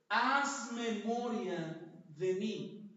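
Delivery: drawn out, slow plea; slow pleading prayer. Shown as a demonstration. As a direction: stretch every word of the plea. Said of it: "Cuál fue la oración". (súplica muy lenta)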